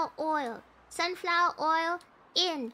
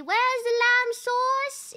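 slow, drawn-out mock bellow, higher pitched; angry chef; 4 semitones higher, 4 dB louder, slower than usual. Player hunting in the kitchen, mock angry-chef bellow. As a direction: bellow it slowly like a demanding chef.

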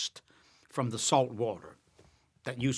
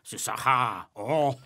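fake French. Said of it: "Sid Caesar used to do all the fake French".

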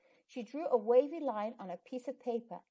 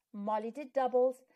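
ordinary delivery

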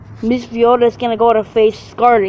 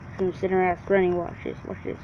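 whispering; 4 semitones lower, 11 dB quieter, faster than usual. Real, not acted. Hushing for neighbors.

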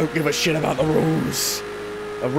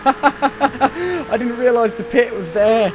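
high, loud, slowed by laughter; real laughing; 8 semitones higher, 5 dB louder, slower than usual. Real, not acted. Player laughing at missing the pit lane, high and loud. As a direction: get the words out through laughter.

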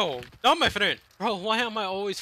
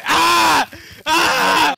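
yelling; 5 semitones higher, 7 dB louder than usual. Real, not acted. Screaming in game fight.

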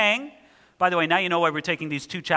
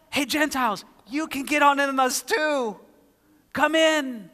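God's invitation; 6 semitones higher than usual. Acted. God's invitation to Gentiles, voiced.

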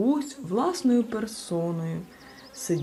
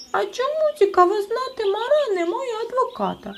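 very high, louder squeaky demand; won't let it go, shrill little voice; persistent; 5 dB louder than usual. A narrator's reading. Chmykh presses the judge about a lawyer, high squeaky. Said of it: "не вгамувався Чмих".

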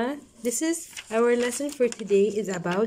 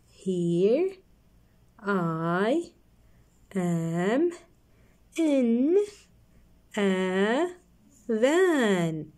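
one word at a time, long pauses; reading aloud haltingly; much slower than usual. Shown as a demonstration. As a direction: read word by word, slowly. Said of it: "read it slowly together".

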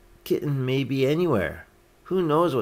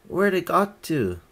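higher-pitched call, lightly raised; mild shout; 8 semitones higher, 4 dB louder than usual. A narrator's reading. Mister Thomas looks for the lost kitten, mildly shouting. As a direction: call out the question without full volume. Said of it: "shouted Mister Thomas".

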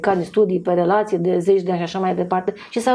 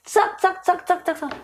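nagging noise; 8 semitones higher than usual. Retold or imitated. Imită cicăleala.

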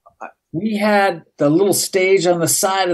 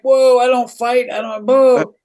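hands up, I don't fight; hammed-up alarm; mock nervous backing off; 6 semitones higher than usual.

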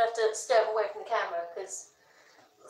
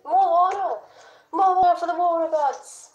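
high, hammed-up proclamation; mock war cry; 8 semitones higher, 4 dB louder than usual. Refilling water for the water gods, mock-epic cry. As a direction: call it out high like a playful offering.

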